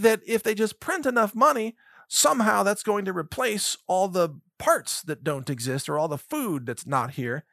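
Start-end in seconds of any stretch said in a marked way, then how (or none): none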